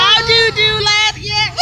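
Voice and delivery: high, loud, breaking into laughter; high-pitched amused exclamation; laughing. Real, not acted. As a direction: Derbyshire banter dissolving into giggles. Laughing, teasing in dialect.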